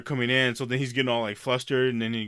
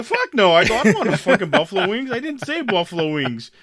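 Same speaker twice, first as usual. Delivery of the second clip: high, loud annoyed retort; retold anger; 9 semitones higher, 8 dB louder than usual. Retelling arguing with the clerk over wings, loud and annoyed. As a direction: snap back at the clerk.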